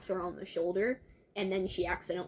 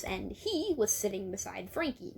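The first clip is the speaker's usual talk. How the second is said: usual speaking voice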